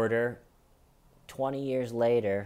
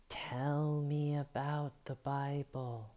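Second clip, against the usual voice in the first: slow, hushed, eerie spirit voice; 3 semitones higher, 7 dB quieter, slower than usual. Retold or imitated. Son recalling mother's spirit speaking, slow and eerie. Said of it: "He said".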